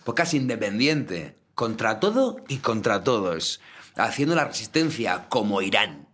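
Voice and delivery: slow, solemn mock manifesto; mock grand slogan. Cohost hypes their indie podcast, slow mock solemn slogan. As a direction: proclaim it like a slow rebel motto.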